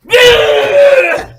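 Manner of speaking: very high, loud roar; Rocky celebrating; mock victory roar. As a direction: roar like a champion jumping up. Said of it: "he's like".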